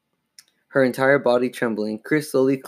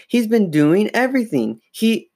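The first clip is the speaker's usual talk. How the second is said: high-pitched urgent insistence; panicked explaining, cut short; frantic; 10 semitones higher, 3 dB louder than usual. A narrator's reading. Girl trying to convince doubting parents, frantic. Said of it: "Chris said frantically".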